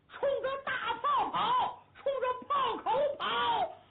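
high, slow and laughing; gleeful order. Acted. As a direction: grin through the crazy order. Hu Dahai orders charge at cannons. (胡大海笑着高声下令)

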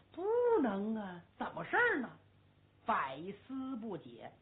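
plain reading voice

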